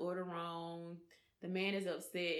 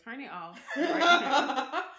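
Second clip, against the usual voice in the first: laughing warning; 3 semitones higher, 9 dB louder, slower than usual. Real, not acted. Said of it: "warns kids, laughing".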